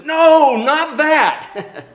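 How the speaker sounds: high, loud, comic cry of refusal; alarmed protest. Acted. Prophet recoiling from God's announced judgment, alarmed protest. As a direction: burst out loudly in mock dismay.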